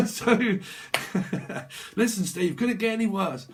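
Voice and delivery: cracking up, high and loud; real laughing. Real, not acted. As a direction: laugh through the words in disbelief. Cracks up at the grim reading.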